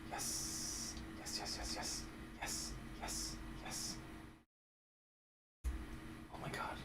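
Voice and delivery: breathless whispered yes; whispered relief. Real, not acted.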